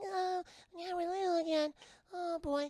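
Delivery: high, squeaky little-creature voice; tiny Monstars voice. Retold or imitated. Shrunken Monstars cheering about being little, squeaky high voice.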